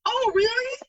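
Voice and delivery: high-pitched, incredulous question; amazed. Retold or imitated. Borrower hearing her friend say yes, high amazement.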